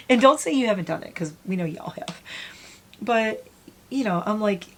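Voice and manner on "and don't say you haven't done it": playful teasing accusation. Real, not acted. Teasing viewers about yelling at sun.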